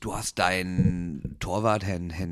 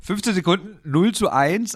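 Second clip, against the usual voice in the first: screaming goal commentary; 9 semitones higher, 6 dB louder than usual. Pretend. Mock live goal announcement.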